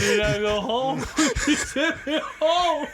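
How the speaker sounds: high laughing voice breaking into giggles. Real, not acted. Cracking up at his friend's story, laughing hard. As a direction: squeal it through real laughter.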